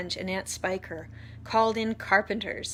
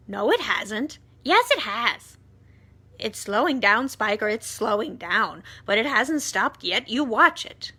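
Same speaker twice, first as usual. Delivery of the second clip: bickering; 8 dB louder than usual. Acted. Aunts arguing over the peach.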